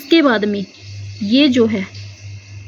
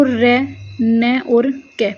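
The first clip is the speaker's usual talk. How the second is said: syllables pulled apart; much slower than usual. Shown as a demonstration. Teacher spells out the syllables.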